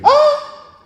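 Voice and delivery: high put-on scream of panicked people; hammed-up shriek; mock scream. Storyteller imitating people screaming at the tornado, a mock high scream.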